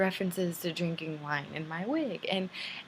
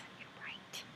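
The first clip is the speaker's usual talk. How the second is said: whisper; 18 dB quieter than usual. Real, not acted.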